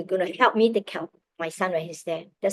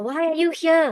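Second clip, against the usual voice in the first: imitating a puzzled official, pitched up high; incredulous questioning; 8 semitones higher, 4 dB louder than usual. Immigration officers question visitors at the border, incredulous. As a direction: ask it in high-pitched disbelief, as if quoting officials.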